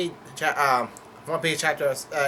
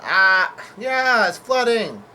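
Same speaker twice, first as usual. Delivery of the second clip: high, loud acted yell; 6 semitones higher, 6 dB louder, much slower than usual. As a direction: shout the alarm as a character. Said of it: "Usopp yells out".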